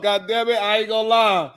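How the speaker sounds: usual speaking voice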